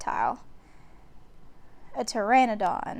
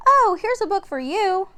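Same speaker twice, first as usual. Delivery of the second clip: higher, louder bright offering; little sister offering a book; eager girl; 9 semitones higher, 6 dB louder than usual. A narrator's reading. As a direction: hand him the book, pleased. Annie voiced.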